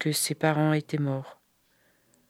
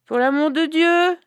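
high, doleful beggar's plea; plaintive beggar voice; 11 semitones higher, 4 dB louder than usual. Acted. Zalgoum repeating her plea at the door, doleful and high. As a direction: beg again with the same pitiful whine. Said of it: "Elle fit sa voix dolente".

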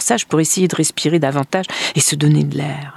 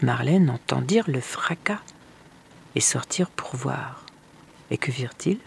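hushed narration; 3 semitones lower, 6 dB quieter than usual. A narrator's reading. Narrator tells of the woman turned to dust, hushed. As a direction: let it fade away into suspense.

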